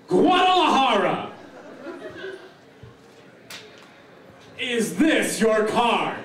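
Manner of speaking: high, loud showman reveal; kids party magician. Acted. Magic trick reveal and applause.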